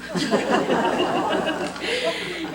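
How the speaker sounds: a burst of genuine laughter at the memory; real laughter. Real, not acted. Remembering her friend's joke at the memorial, real laughter.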